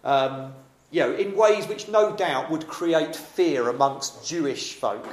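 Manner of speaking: ordinary delivery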